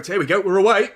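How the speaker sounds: building from eager to screaming at the top of the voice; rising to a full scream as Haaland scores; goal celebration. Real, not acted. City score against Arsenal.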